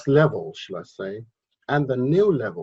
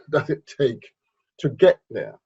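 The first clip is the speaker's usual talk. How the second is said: ordinary talk, nothing marked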